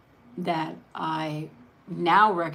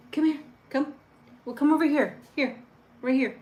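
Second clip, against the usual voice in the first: high, louder call to the dog; coaxing pet voice; 8 semitones higher, 4 dB louder than usual. Real, not acted. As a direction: coo her over.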